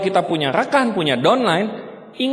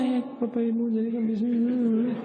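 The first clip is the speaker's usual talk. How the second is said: limp, soft mock presenter; mock mumble; 2 semitones higher, 8 dB quieter than usual. Mocking a timid presenter speaking to prospects, soft mumble. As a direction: mumble it away like a weak pitch.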